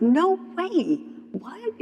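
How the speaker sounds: high-pitched retold astonishment; mock disbelief. Reacting to friend's thirty days sober, astonished.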